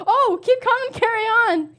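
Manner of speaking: high, loud burst of getting it; excited realisation. Real, not acted. Player finally solving the card, high loud excitement. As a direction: blurt the answer in triumph.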